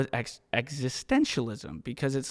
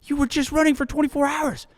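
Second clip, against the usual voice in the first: amazed onlookers; 13 semitones higher than usual. Retold or imitated. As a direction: play stunned spectators. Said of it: "people are like".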